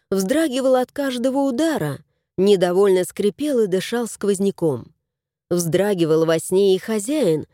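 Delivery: usual speaking voice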